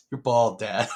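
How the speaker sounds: imitating a kid's matter-of-fact remark. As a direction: state it plainly, like an innocent child. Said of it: "he said it just matter-of-factly".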